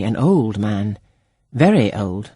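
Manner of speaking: ordinary delivery, nothing marked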